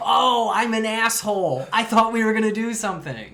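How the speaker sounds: a fan shouting in mock shock; mock outrage. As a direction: cry out in hammed-up disbelief.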